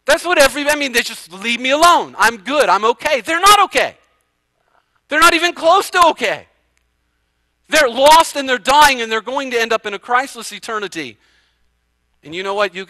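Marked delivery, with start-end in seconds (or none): 3.2-9.5 forceful rebuttal. Real, not acted. Preacher's emphatic rebuttal.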